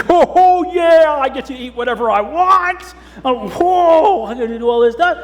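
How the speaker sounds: put-on high, loud giddy kid; excited child. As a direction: squeal with delight. Kid imagining birthday privileges.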